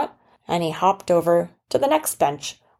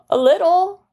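put-on sheepish boy; 12 semitones higher, 5 dB louder than usual. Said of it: "And the boy said".

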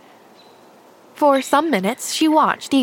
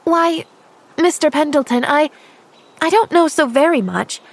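put-on earnest little girl; 3 semitones higher than usual. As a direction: play a guileless girl answering every question. Pollyanna answers literally.

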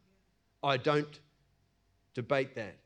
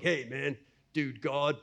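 mock irreverent; 5 semitones lower, 4 dB quieter than usual. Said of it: "No, no, we don't talk like that".

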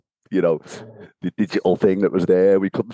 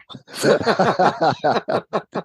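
long high laugh rising at the end; real high laugh; 11 semitones higher than usual. Real, not acted. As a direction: burst out laughing at the joke. Laughing at shower joke.

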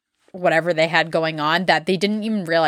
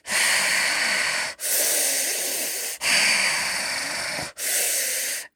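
heavy breathing; 6 dB quieter than usual. Retold or imitated. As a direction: imitate a man breathing very heavily, no voice. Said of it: "he was like this".

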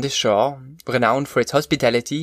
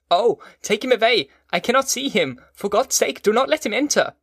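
high-pitched, desperate pleading; agonized cry; 9 semitones higher than usual. A narrator's reading. Prisoner begging that the visitor be kept out, agonized. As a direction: cry out in agony, voice lifted high. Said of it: "cried out in agony".

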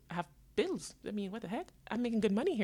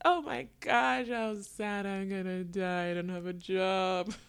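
a slow, hammed-up lament with a laugh in it; mock despair; 2 semitones higher, 6 dB louder, slower than usual. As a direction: overplay the doom, dragging the words slowly. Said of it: "the other side was".

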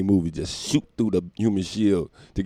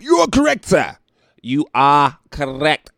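high, loud, drawn-out host announcement; game show host; 7 semitones higher, 9 dB louder, slower than usual. Pretend. To the listener guessing, mock quiz host.

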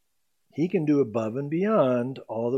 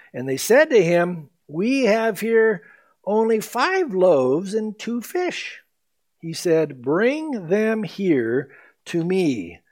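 slow, measured Bible reading; scripture reading; 3 semitones higher, 3 dB louder, slower than usual. Acted. Pastor reading the verse to the congregation, slow and measured. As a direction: read the verse slowly and deliberately.

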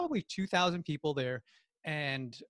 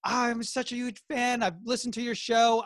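gushing fan; 8 semitones higher, 5 dB louder than usual. Retold or imitated. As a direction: imitate a thrilled fan, stretching the words. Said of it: "I'm with a fan of yours".

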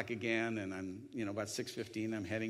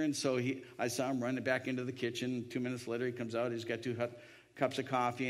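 ordinary talk, nothing marked

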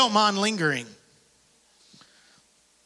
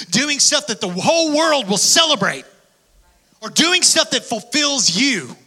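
rising preaching; 7 semitones higher, 4 dB louder than usual. Real, not acted. Preaching rising to full force.

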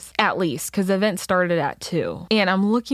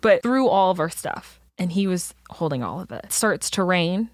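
ordinary talk

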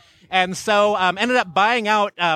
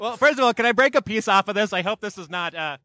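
normal talking voice

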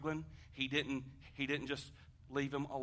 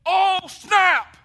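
high, loud startled shout; sudden high yelled alarm; panicked shout; 21 dB louder than usual. Retold or imitated. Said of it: "I said".

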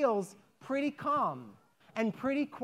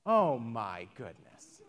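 much lower, softer, slow worn-out sigh; weary sigh; 12 semitones lower, 4 dB quieter, slower than usual. Acted. The woman after another sleepless night, low slow weary sigh. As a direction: let it all drain out, exhausted.

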